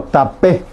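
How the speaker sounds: tapé with the final é stressed; acento en la E. Shown as a demonstration. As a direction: lean on the last vowel of tapé. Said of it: "pronunciamos más fuerte en la E". (tapé, acentuado en la última vocal)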